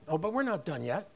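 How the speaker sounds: ordinary delivery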